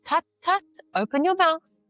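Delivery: high, firm motherly order. A narrator's reading. Yashoda ordering little Krishna, high and firm. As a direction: tut at him and command it. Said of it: "orders Yashoda".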